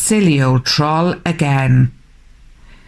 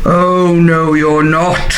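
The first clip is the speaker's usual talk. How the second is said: mildly roared troll voice; understated troll roar. A narrator's reading. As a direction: roar it lightly like a storybook troll. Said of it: "roared the troll".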